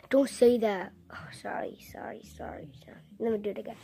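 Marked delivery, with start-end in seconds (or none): 1.1-3.8 very soft whispered apology; hushed apology; whispered sorry. Real, not acted.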